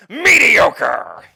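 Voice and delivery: loud, high, drawn-out shout; bellowed catchword. Pretend. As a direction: roar it out as a sudden punchline. Dreaded catchword bellowed at the end.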